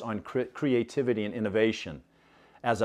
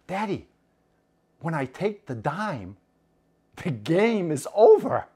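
put-on high child voice, knowing punchline; clever little girl; 5 semitones higher than usual. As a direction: reveal the secret with a sly child's pride. Child reveals her trick.

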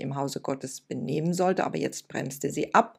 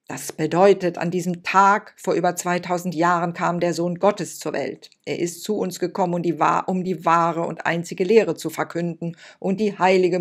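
loud, carried-away sermon voice; pompous loud preaching to a child; strange rapt preaching; 8 dB louder than usual. Acted. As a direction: preach as if moved by yourself. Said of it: "sprach jetzt auf eine sehr merkwürdige Weise".

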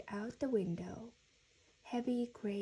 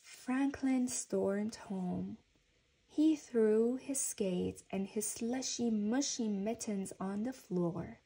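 ordinary delivery

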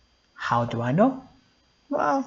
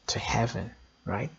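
whispered; 6 semitones lower, 11 dB quieter, faster than usual. Acted. Sermon hushed climax.

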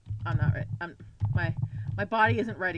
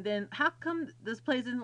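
ordinary talk, nothing marked

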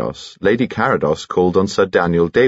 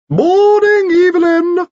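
very high, louder piping call from outside the door; extraordinarily high-pitched voice; 7 dB louder, slower than usual. Acted. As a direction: pipe it up in a tiny falsetto. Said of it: "said an extraordinarily high-pitched voice".

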